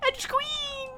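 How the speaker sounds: very high excited squeal. Real, not acted. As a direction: squeal it in delight.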